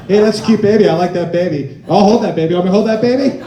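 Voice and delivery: loud, mock-eager calling out; hammed-up eagerness to hold someone's baby; over-eager stranger. Hanging round playground offering to hold babies.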